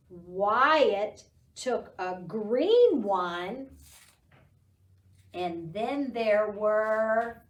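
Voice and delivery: slow, sing-song poem reading; teacher reciting a counting poem to toddlers, slow sing-song; poem recitation. Acted. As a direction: recite the rhyme slowly for children. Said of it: "So the poem goes".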